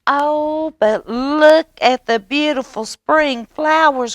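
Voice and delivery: high eager frog voice; peeper crying out. A narrator's reading. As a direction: plead brightly like a tiny frog. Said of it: "cried the peeper".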